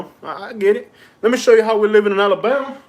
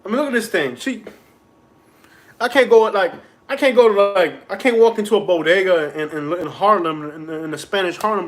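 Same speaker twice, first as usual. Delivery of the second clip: ordinary talk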